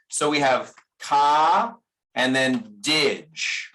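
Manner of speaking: "ka" and "dij", slow, with a flapped D; American flap T. Shown as a demonstration. Teacher spelling out the American flap in cottage, slow. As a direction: split it slowly into "ka" and "dij". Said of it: "Americans don't like the internal T".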